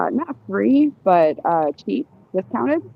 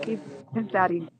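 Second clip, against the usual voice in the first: turning away from phone; 8 dB quieter than usual. Real, not acted. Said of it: "Sorry, talking to my son".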